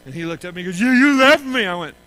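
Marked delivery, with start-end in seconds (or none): 0.8-1.6 louder, higher hurt reproach; upset accusation. Retold or imitated. Hurt boy repeating that dad left him. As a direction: push the blame through tears.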